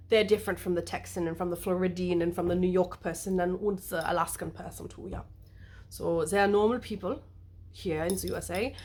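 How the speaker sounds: put-on playful German-accented explanation. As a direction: slip into a mock German accent. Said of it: "I'll back to normal".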